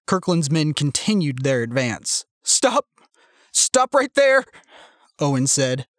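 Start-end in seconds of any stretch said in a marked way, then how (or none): 2.4-4.4 high-pitched stock-coward panic; over-the-top panic. A narrator's reading. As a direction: panic like a coward in an old film. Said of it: "The panic in his voice was over the top".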